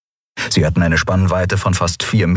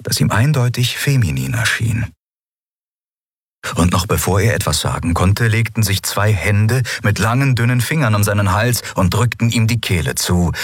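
plain reading voice

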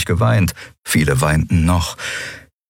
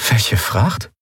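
higher, puzzled question; taken aback, pitched up; baffled; 4 semitones higher than usual. Acted. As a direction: ask in blank astonishment. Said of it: "sah ihn verblüfft an".

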